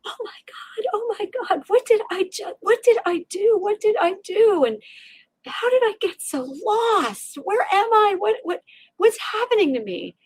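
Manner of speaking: waking up terrified in the car; panicked. Retold or imitated. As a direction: gasp it out in disbelief and terror. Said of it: "I was like".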